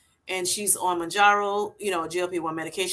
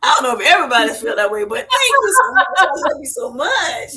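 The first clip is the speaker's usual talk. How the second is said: loud, high, laughing through the words; laughing thanks; 8 semitones higher, 8 dB louder than usual. Real, not acted. Host thanks guest for calling her queen, laughing hard.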